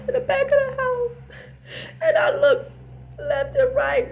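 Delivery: hammed-up weeping prayer; mock crying. Friend recounting the search to Pa, mock crying.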